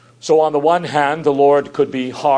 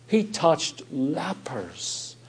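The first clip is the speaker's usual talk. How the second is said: drawn-out, hushed repeat; very slow and soft; 7 dB quieter, much slower than usual. Acted. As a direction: let each word sink in, very slowly. Repeated line, slower and softer.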